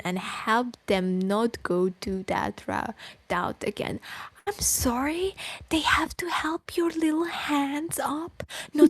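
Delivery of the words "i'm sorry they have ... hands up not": high, mocking whisper. Real, not acted. Streamer mocking the writer in a high whisper. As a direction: sneer it out in disbelief.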